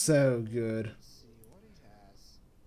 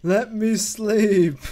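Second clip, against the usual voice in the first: slow, high, loud drawled complaint; mocking the sleepy AI, a drawn-out whine; mock sleepy grumble; 6 semitones higher, 5 dB louder, much slower than usual. Player mocks sleeping NPC.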